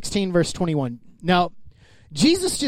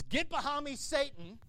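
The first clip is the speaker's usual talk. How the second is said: hushed rebuke; 6 semitones higher, 17 dB quieter than usual. Retold or imitated. Jesus answering a complaining believer, hushed and cold. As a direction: say it softly but cutting.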